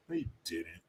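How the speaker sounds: soft, low mutter of doubt; muttered disbelief. Real, not acted. Doubting Kamala's story to himself, softly muttered. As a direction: say it under your breath, unconvinced.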